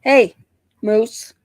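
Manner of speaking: calling the dog. Real, not acted.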